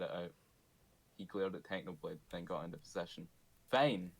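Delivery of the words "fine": spat out. Acted. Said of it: "he spat".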